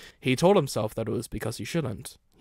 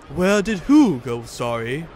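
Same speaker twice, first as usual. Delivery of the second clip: loud puzzled engine; 3 semitones higher, 10 dB louder than usual. Acted.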